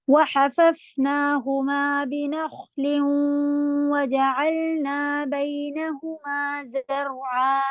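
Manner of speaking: very slow Quranic recitation. Shown as a demonstration. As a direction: recite slowly, drawing out the vowels. Models the verse recitation. (بہت آہستہ تلاوت)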